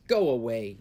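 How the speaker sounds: loud gruff guard telling him off; a guard shouting him away; guards' shout. A narrator's reading. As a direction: bark it like a gate guard. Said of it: "said the guards".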